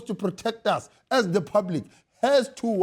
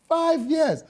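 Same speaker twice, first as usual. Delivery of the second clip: high, incredulous exclamation; outraged disbelief; 9 semitones higher than usual. Real, not acted. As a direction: exclaim it, scandalised. Repeats the figure in disbelief.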